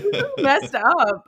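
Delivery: high, slow, laughing indignant voice; hammed-up offended whine; mock outrage. Comic reacting to her bad month, mock outrage. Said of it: "I was like".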